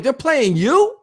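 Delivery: high, rising unbelieving question; can't believe it; disbelief. Retold or imitated. Disbelief at the news.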